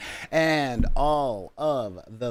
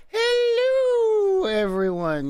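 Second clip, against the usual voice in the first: shrill falsetto shriek of hello, much higher and louder; Mrs. Doubtfire voice; 4 dB louder, slower than usual. Retold or imitated. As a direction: squeal it like an overtired nanny. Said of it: "I channel Miss Doubtfire".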